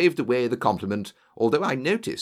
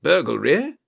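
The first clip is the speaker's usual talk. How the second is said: loud, raised questioning cry; sudden louder, higher astonishment; startled cry; 4 semitones higher, 7 dB louder than usual. A narrator's reading. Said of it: "cried the Colonel".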